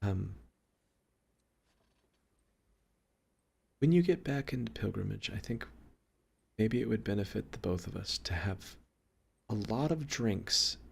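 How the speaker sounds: soft, sheepish and halting. Acted. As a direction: speak softly, awkwardly offering to make peace. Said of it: "sheepishly, and says".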